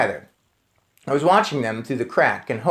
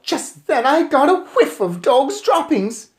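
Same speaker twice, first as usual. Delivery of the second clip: ordinary delivery, nothing marked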